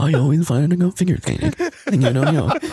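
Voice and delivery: rushed synthesized computer voice; robot voice. Pretend. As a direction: rattle it off like a speech synthesizer. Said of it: "forgot you could do the robot voice".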